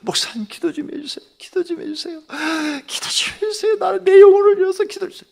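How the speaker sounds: acted plea rising to a cry; desperate pleading. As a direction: imitate someone begging urgently for prayer. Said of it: "사정을 하는 거야".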